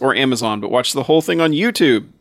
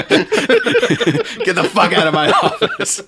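slow, laughing mock boss yell; 6 semitones higher, much slower than usual. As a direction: bark it out while cracking up. Acting the producer, laughing.

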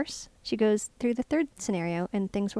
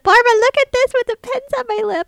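high, loud retold call for attention; excited showing off; 14 semitones higher, 12 dB louder than usual. Calling Barbara to look at the pens, excited mock shout. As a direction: shout it like a kid showing off a silly trick. Retelling showing off a silly stunt.

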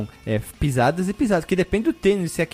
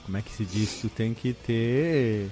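stifled giggles; 5 semitones lower, 4 dB quieter than usual. Real, not acted. Giggling while searching words.

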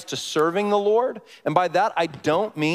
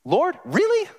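high incredulous cry; exasperated lord really; 13 semitones higher than usual. Retold or imitated. Pastor retelling his snowed-out retreat, incredulous high exasperation. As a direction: throw up your hands at God. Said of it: "And I remember thinking".

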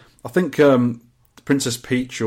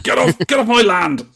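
raised, higher shout to clear off; angry old owner; 7 semitones higher, 4 dB louder than usual. Pretend. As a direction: chase the kids off, loud. Virtual Andy chasing kids away.

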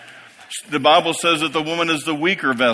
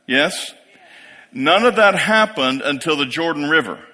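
ordinary delivery

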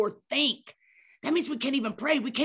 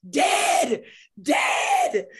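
shouted, higher, playing up dying; mock yell; 6 semitones higher, 5 dB louder than usual. Answering the nurse's question about her name, a mock dying yell. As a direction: yell it like you're on your last breath. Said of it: "I'm like".

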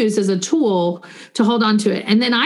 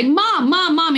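put-on higher-pitched child calling for mom; 6 semitones higher, slower than usual. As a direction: imitate a kid calling mom to get her attention. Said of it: "getting louder and louder".

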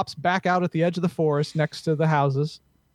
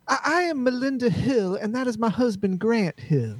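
higher-pitched Southern society lady; upper-crust Southern accent; 7 semitones higher than usual. Acted. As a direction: introduce yourself as genteel Southern gentry. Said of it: "all the upper crust all have that Southern accent".

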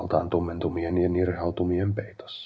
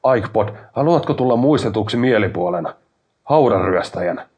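loud, raised-pitch reproach; angry scolding; 4 semitones higher, 9 dB louder than usual. A narrator's reading. Brother warns Aikpod about his ruined reputation, angry scolding. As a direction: scold him in a raised, exasperated voice.

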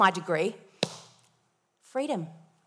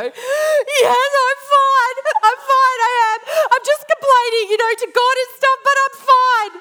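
very high, loud, sobbing "I'm fine"; tearful reply to a stranger at the lights; crying reassurance; 10 dB louder than usual. Retold or imitated. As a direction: insist you're fine while sobbing. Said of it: "I go".

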